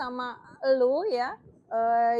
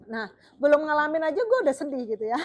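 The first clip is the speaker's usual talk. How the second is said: laughing; 4 semitones higher, 6 dB louder than usual. Real, not acted. Laughs at own sadness.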